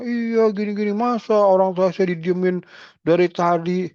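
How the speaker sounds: high, aggrieved mock protest; an upset son whose parent was ignored; complaining son. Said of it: "marah-marah".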